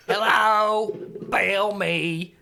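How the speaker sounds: slow, held syllables on a level pitch; a captive creature trying out human words; drawn-out, simple creature speech; Belle's voice. Acted. Voicing Belle's few words.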